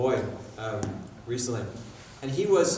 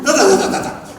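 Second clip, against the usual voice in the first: mock rushing sounds; 7 semitones higher, 15 dB louder than usual. Parent rushing onto the pitch.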